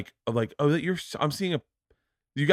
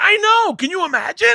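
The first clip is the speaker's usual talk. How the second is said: much higher, louder eager voice; oblivious comic; 8 dB louder than usual. Retold or imitated. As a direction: play him proud and clueless.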